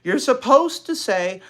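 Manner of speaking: put-on high and loud child voice; bossy little girl. Little sister correcting her sister's reading. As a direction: instruct her sister bossily. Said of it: "said Beezus crossly".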